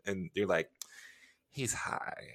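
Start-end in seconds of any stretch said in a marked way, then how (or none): 1.5-2.3 soft whispered aside. Retold or imitated. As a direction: whisper it behind a hand. Friend whispering an explanation.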